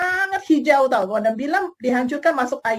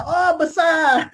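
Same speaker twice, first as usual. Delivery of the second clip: mock naive buyer; 3 semitones higher, 3 dB louder, much slower than usual. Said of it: "Jangan hanya kata".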